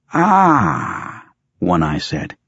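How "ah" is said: high, drawn-out "ah" of realization; lightly acted surprise; sudden recollection. A narrator's reading. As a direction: let it jump up high as it dawns on you. One Eye suddenly remembers someone.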